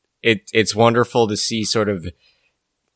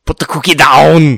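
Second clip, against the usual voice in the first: loud, higher mock Austrian accent shout; Schwarzenegger impression; 5 semitones higher, 10 dB louder than usual. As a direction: yell it like a hammed-up Schwarzenegger. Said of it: "Schwarzenegger accent".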